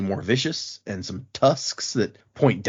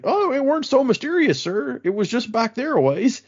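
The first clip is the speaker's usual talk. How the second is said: high, hammed-up Irish lilt for the cart driver; a high Irish cabbie, cheerfully unbothered; Irish accent; 11 semitones higher than usual. Cart driver tells Adolin Wit ran off, Irish accent. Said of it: "anyone with an Irish accent yet".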